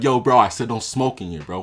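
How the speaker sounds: loud, stern call-out to a smoker; loud scolding. Pretend. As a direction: tell him off loudly. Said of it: "I gotta be like".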